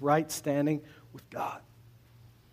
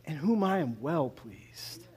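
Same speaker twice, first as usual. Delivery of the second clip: slow and soft; tender heavenly voice; 4 dB quieter, slower than usual. Retold or imitated. Preacher voicing heaven's loving words, slow and soft. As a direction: lay it down gently, slowly. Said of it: "the voice from heaven said".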